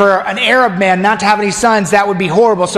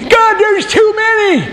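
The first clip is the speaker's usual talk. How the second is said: high, slow comic wail; Abraham exasperated; 14 semitones higher, slower than usual. Acted. Abraham tells God the stars are too many, high comic wail. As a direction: whine it out, overwhelmed.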